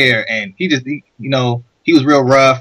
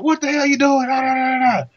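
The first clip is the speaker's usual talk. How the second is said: high-pitched imitated rant; mock angry neighbor; 11 semitones higher than usual. Neighbor confronts boy who backed into garage, mock outrage. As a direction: trail into nonsense syllables like a ranting neighbor.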